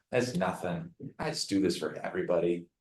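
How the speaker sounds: dropped low and soft, quickly waving away the thanks; mock-modest shrug of a reply; the wrong, self-deprecating way to take thanks; humble brush-off. Shown as a demonstration. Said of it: "It's like the humble, that's terrible".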